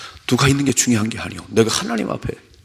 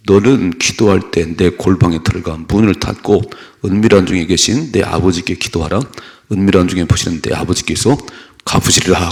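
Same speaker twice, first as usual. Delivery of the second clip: scripture reading; 3 semitones lower, 5 dB louder than usual. Acted. Preacher reading Matthew aloud, loud and measured. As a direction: read it out to the congregation.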